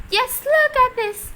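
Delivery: high, loud excited call; excited shout. A narrator's reading. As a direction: call him to come see. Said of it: "she was shouted".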